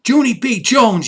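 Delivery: high, loud call of her name; mother's loud angry call; yelling name. A narrator's reading. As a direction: yell for the runaway child. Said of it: "yelled Mother".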